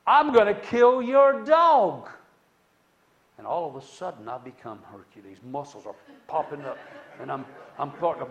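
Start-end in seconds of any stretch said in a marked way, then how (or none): 0.0-2.1 loud slow threat, much higher; neighbor's loud slow menacing threat. Retold or imitated. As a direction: roar the threat the second time. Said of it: "he says it a second time".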